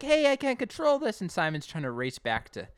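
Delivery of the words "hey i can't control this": much higher, louder alarmed cry; mock panic. Connie losing grip on the sprayer, high and alarmed. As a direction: sound like the hose is getting away. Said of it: "Connie's like".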